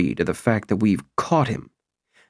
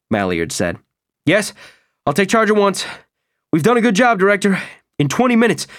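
higher, louder, fast keyed-up officer; excited officer voice; 9 semitones higher, 3 dB louder, faster than usual. Acted. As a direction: rush the report out, agitated commander. Malliard reports by radio.

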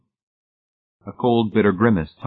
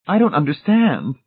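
high, louder, lightly distressed; troubled; 11 semitones higher, 6 dB louder than usual. A narrator's reading. Mrs Pritchett cannot follow Hamilton, high and troubled. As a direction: lift the voice in puzzled worry.

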